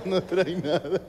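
mock laughing through the words; doctor cracking up at the patient; imitated laughing doctor. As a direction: giggle it out, rising at the end. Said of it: "Riéndose el hijo de puta".

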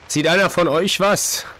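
ordinary talk, nothing marked

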